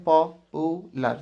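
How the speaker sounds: পপুলার drawn out very slowly in three separate syllables. Shown as a demonstration. Teacher showing students the syllable count, পপুলার said very slowly in parts. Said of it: "আস্তে আস্তে উচ্চারণ করলেই বেরিয়ে যাবে".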